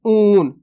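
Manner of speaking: ऊन held as a firm "ooon"; stressed long vowel. Shown as a demonstration. Teacher repeats the right reading for pupils, forceful long ऊन. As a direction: press and stretch the vowel of ऊन. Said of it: "थोड़ा जोर लगा के पढ़ते हैं".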